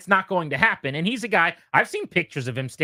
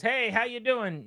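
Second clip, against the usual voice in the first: breezy greeting; 7 semitones higher, 6 dB quieter, slower than usual. Retold or imitated. Voices Joe greeting the secretary.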